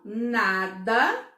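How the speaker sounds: "nada" slowly, both syllables sounded long; teacherly, measured "nada" in two beats; syllables stretched out. Shown as a demonstration. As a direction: draw out "nada" as na-da for counting. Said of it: "o som sai quantas vezes?".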